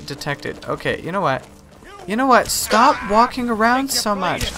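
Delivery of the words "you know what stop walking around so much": exasperated. Real, not acted. Irritated at wandering enemies.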